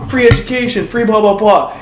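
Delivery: high-pitched mock campaign promise; Bernie Sanders. Candidate throwing things out to see what sticks, mock proclaiming. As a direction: toss out slogans to a crowd. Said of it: "And so if he's like".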